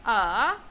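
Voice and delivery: scoop "a" down and up; falling-rising tone. Shown as a demonstration. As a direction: third tone on "a". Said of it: "falling rising tone".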